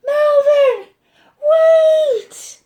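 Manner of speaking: very high, loud call. Acted. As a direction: shout after him down the street. Pete calling out down the street.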